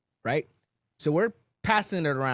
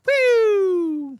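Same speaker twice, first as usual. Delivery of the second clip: high, loud "woo" like a siren; sudden piercing police-siren whoop; imitated siren whoop; 8 dB louder than usual. Right when he parks, imitated siren whoop.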